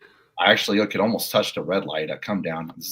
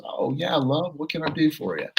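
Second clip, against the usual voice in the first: a lightly British lady, much higher; cheery British partner greeting a colleague; mock British accent; 9 semitones higher than usual. British partner answering her colleague, breezy mock British. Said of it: "and she's British".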